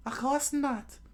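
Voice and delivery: very high falsetto retort; Nami character voice. Acted. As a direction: answer back in a high put-on girl's voice. Nami's lines in the reading.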